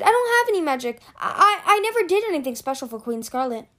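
very high, stammering denial; protesting. A narrator's reading. Captive denies serving the queen with magic, protesting. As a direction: plead innocence, voice leaping up. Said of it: "Pirate protested".

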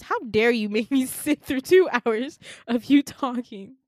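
loud, laughing exasperated protest; half-joking indignant outburst; mock outrage. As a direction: pretend fury at being made to listen.

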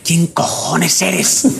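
very slow, drawn-out mock mother; suspicious mother. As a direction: drag it out like a spooked mum. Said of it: "Mi madre". (madre asustada que alarga cada palabra)

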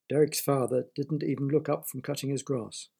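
ordinary delivery

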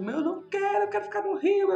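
high, weepy reenacted plea; retold crying plea. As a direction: replay the sobbing protest, voice climbing high. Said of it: "eu chorava de soluçar". (súplica chorosa, em voz bem aguda)

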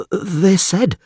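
high-pitched, hesitant villager; mumbling villager. A narrator's reading. Villager excusing himself to the alderman, mumbling. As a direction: mumble it as a timid peasant, high. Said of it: "mumbled the villager".

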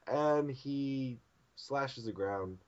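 normal talking voice

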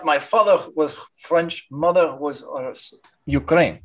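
put-on halting Ukrainian-accented explanation; thick Eastern European accent. Doctor explaining French father and Ukrainian mother, Ukrainian accent. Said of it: "I have Ukrainian accent".

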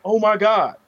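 high, mildly scandalized mock gasp of words; mock dismay. Advisory staff reacting to his email, mock dismay. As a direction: imitate an overdramatic, appalled reaction.

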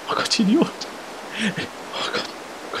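hushed, voiceless shock; horrified whisper. Acted. Man alone after his friend fell, horrified whisper. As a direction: whisper it to yourself, stunned.

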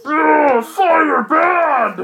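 high, loud caveman monster cry; dumb monster roar. Acted. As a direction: roar it like a brute afraid of fire. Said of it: "and Boltmon goes".

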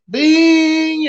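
high, loud "Bing" like a ringing hit; punch sound. Retold or imitated. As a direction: ring out "Bing" like a bell struck by a punch.

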